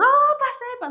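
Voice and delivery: high, loud re-enacted yell; elated shout. New lawyer bursting out of the school bathroom, elated shout. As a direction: cry out the good news, pitch leaping.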